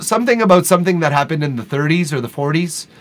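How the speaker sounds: normal talking voice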